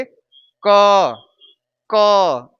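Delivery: क loud and drawn out as "kuhh"; emphatic, falling क with the vowel audible; letter sound model. Shown as a demonstration. Teacher showing how the letter is said, stretched vowel. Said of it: "इसे बोलेंगे".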